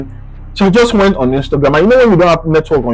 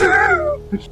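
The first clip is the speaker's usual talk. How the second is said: amused laugh; 6 dB quieter than usual. Real, not acted. Laugh at near deportation.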